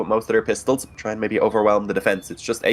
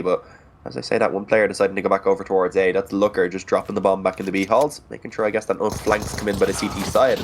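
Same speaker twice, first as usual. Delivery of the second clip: ordinary talk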